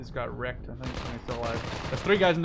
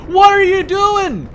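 high, slow, loud shocked yell; 20 dB louder, slower than usual. Real, not acted. Player yelling at his own tank in shock. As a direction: yell it out in total disbelief. Teammate's tank does something.

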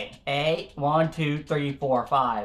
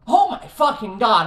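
loud, exasperated curse; a raging gamer cursing out a laggy level; furious outburst; 6 semitones higher, 9 dB louder than usual. Real, not acted. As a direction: blow up at the game.

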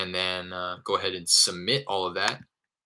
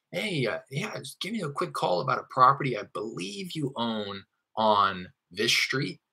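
higher voice, drawing out the end as if not quite sure; tentative script tonality; 6 semitones higher than usual. Shown as a demonstration. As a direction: float it tentatively, stretching "on this street". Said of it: "the way that I said it in that tonality".

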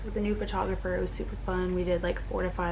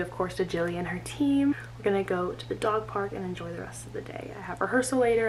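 normal talking voice

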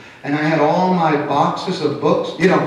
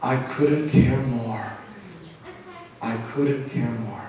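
a father who truly couldn't care less; cold indifference; 6 semitones lower, 10 dB quieter than usual. Retold or imitated. Preacher voicing an uncaring father, low cold indifference.

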